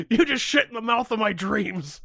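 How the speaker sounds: loud, high mock tirade; mock cursing out. Imagining the friend whose hopes were dashed, mock outraged. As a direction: wail it in hammed-up outrage. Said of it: "picture you cursing me out later".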